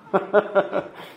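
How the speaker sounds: kurz auflachen; kurzes Lachen. Real, not acted. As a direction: ein kurzes, hohes Lachen. Lacht nach dem Zitat. (kurzes Auflachen über das Zitat)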